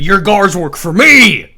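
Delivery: loud, slow, high-pitched imitation; hammed-up domineering shout; retold shouted retort. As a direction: act out an overpowering comeback, drawn out. Retold attacker shouting back.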